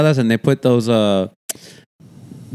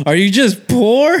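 put-on higher, slow, half-laughing child voice; imitating little girl; 12 semitones higher, 5 dB louder, slower than usual. Six-year-old cousin roasting him about money, mock innocent child. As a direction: deliver the kid's brutal punchline innocently. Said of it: "And she goes".

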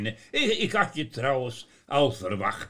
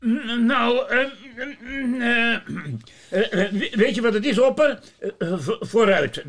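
put-on flustered old Sinterklaas; 10 semitones higher, 5 dB louder than usual. Sinterklaas hesitant, high voice.

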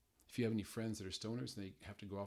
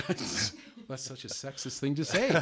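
slow, louder, laughing through it; 5 semitones higher, 6 dB louder, slower than usual. Real, not acted. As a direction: laugh while teasing yourself. Laughing at his own joke.

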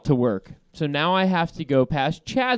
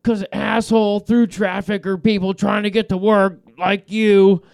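higher, louder brush-off ending in blah blah; dismissive rant; 5 semitones higher, 4 dB louder than usual. Retold or imitated. Host mimicking Chad brushing him off before hanging up. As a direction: wave him off and babble. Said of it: "And then click, hangs up on me".

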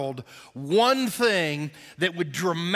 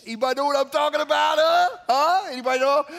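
high, loud, rapid rhetorical questions; playful prodding; 7 semitones higher, 4 dB louder, faster than usual. Real, not acted. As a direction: needle the crowd for agreement. Teasing audience after no wrinkles.

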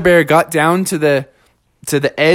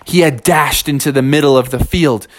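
usual speaking voice

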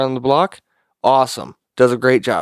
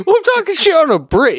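high, laughing self-defence; laughing protest; 5 dB louder, faster than usual. Real, not acted. Co-host defending himself against racism teasing, laughing protest. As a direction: protest through real laughter, pitched way up.

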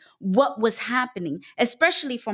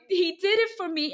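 retold crying; 8 semitones higher than usual. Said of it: "crying and I'm like".

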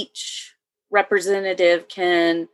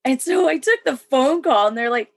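ordinary talk, nothing marked

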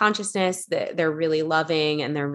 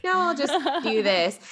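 mock-casual friend, pitched up high; 9 semitones higher than usual. Imitating the friend shrugging off her gift, casual. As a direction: imitate her breezy brush-off in a higher voice. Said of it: "kind of so casual about it".

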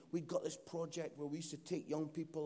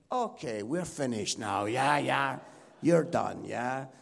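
very loud, comic wind-up call; mock dismissal; 5 semitones lower, 14 dB louder, slower than usual. Church telling the preacher he's done, loudly dismissive. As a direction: belt out a mock hint to stop. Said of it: "that's like the church's way of saying".

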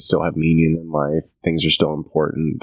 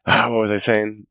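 usual speaking voice

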